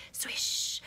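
soft drum-brush swish. Pretend. Reader imitates the fly's brushes, breathy swish. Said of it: "the fly swished his brushes".

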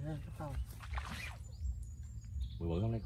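ordinary talk, nothing marked

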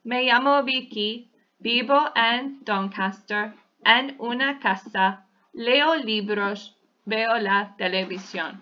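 slow, measured Spanish reading; beginner read-aloud. Shown as a demonstration. Teacher showing how a beginner's Spanish paragraph might sound, slow read-aloud. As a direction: read it out phrase by phrase, unhurried. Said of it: "It might sound like this".

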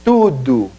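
tudo said tudu with a u ending; Portuguese tudo. Shown as a demonstration. (tudo pronunciado tudu)

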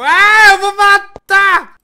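loud, high, slow furious shout; raging yell. Real, not acted. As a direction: scream it out of pure frustration. Gamer raging at puzzle.

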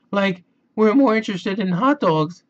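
high, loud goofy Shaggy voice; laid-back hungry slacker. Acted. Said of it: "says Shaggy".

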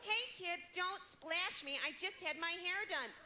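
high, drawn-out mock plea to splashing kids; fussy mother. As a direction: stretch it out as a hammed-up fretful mother. Mimics mother fussing in pool.

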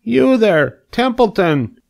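high pig call. A narrator's reading. Pig calls the rat over, calling out. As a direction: hail someone across the yard as a pig. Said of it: "he called".

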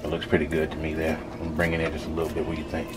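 normal talking voice

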